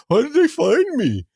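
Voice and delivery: high, far-off dopey voice; mock clueless criminal. Criminal who posted his crimes, baffled and high. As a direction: play the dim crook, baffled. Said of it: "get caught and go".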